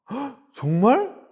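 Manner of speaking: high, drawn-out gasp of disbelief; amazed. Acted. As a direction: react astonished to wild news. Acted listener amazed at the rumour. (깜짝 놀라 높은 소리로)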